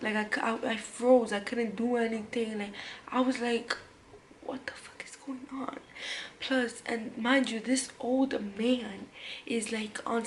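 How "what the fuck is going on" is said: unvoiced, stunned under the breath; hushed retold disbelief; shocked whisper. Retells her shocked reaction.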